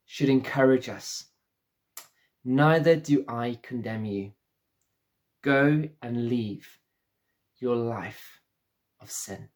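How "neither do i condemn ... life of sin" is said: tender, slow Jesus quote. Acted. Pastor to the congregation, repeating Jesus' mercy, slow and tender. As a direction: let it fall away gently, slowly.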